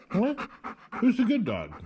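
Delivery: high praising question; high dog-talk voice. Real, not acted. Praising Buster as a good dog, high praising voice.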